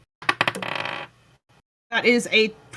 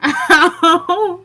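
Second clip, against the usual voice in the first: mock pain, laughing; 6 semitones higher, 4 dB louder than usual. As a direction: giggle through a fake ow. Players mock the deadpan ow.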